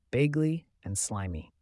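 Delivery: usual speaking voice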